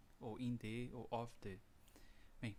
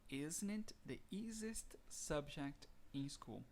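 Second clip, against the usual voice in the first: slow model sentence; 3 semitones higher, much slower than usual. Shown as a demonstration.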